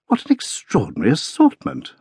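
taken aback by the oddness; astonished exclamation. A narrator's reading. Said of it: "I exclaimed".

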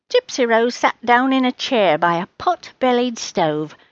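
plain reading voice

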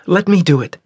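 high, quick protest; insistent Osira. Acted. Daughter interrupting father to volunteer, quick and insistent. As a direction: cut in fast and high.

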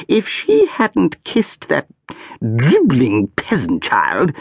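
high, slow, scornful aristocrat; haughty archduchess. A narrator's reading. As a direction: sneer at the servants. Archduchess scorns dead mother.

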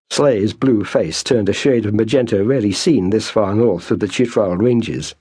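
usual speaking voice

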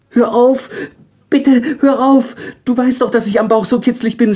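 very high, loud, squealing plea; a little angel squealing to stop the tickling; high pleading angel. A narrator's reading. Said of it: "schrie Ambrosius".

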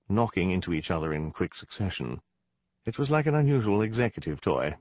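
usual speaking voice

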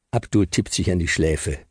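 usual speaking voice

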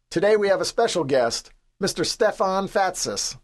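high, projected announcer's bellow; game-show introduction. A narrator's reading. Captain introducing a special guest to the team, announcer-style bellow. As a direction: present the guest like a talent-show contestant. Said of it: "like I'm the next contestant on American Idol".

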